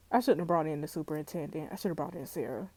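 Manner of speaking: low, soft self-reproach; muttered regret. Real, not acted. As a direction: say it to yourself, defeated. Regretting choice under breath.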